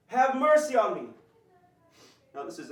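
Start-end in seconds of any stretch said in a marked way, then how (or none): 0.1-1.1 loud, high pleading shout; desperate shouting. Acted. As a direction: cry out for mercy at full voice. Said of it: "He's saying".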